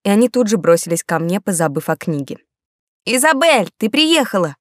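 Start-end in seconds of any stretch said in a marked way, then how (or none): 3.0-4.5 excited. Acted. Boys excitedly greet her.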